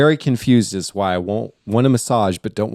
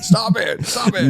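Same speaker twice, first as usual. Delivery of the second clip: laughing protest; 11 semitones higher than usual. Real, not acted.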